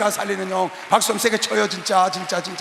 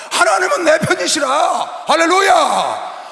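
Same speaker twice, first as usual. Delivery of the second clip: preaching shout; 7 semitones higher, 7 dB louder, slower than usual. Acted. Preaching at full force.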